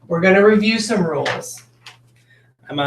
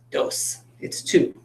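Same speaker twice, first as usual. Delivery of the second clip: whisper; 4 semitones lower, 6 dB quieter, slower than usual. Real, not acted. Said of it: "quiet aside to the class".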